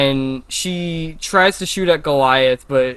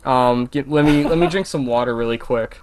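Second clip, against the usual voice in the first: usual speaking voice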